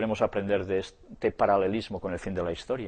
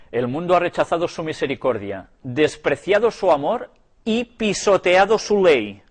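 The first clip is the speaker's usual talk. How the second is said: lectura enfática; 5 semitones higher, 5 dB louder than usual. A narrator's reading. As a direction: declaim the quote, rising in force. Lee una cita con fuerza. (lectura declamada que crece en fuerza)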